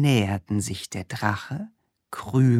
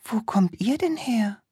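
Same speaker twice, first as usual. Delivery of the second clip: princess voice; 10 semitones higher than usual. Acted.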